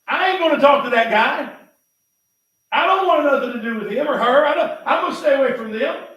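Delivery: loud, high mock grumpy refusal; grumpy loner act. Preacher acting out someone who won't make friends, grumpy. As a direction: play a sulky person shutting everyone out. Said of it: "by going like this".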